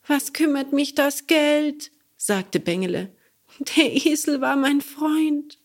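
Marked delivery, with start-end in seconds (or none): 0.1-1.9 high, louder outraged cry; indignant puppet boy. Acted. Bengele protests. 3.8-5.6 high, louder outraged cry; indignant puppet boy. Acted. Bengele protests.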